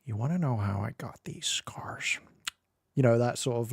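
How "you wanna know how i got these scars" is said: whispered menacing Ledger Joker; Heath Ledger Joker. Retold or imitated. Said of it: "Heath Ledger, going".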